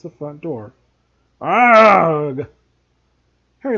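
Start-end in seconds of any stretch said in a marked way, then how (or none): 1.4-2.5 sudden loud startled roar; jolted awake with a yell. A narrator's reading. Vernon stepped on in dark.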